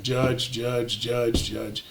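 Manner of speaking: low, soft monotone repetition; droning crowd imitation. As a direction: mimic people filing past, one after another. Imitates people queueing before commissioner.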